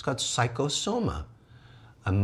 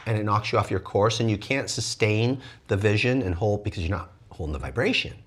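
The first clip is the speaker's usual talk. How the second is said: ordinary delivery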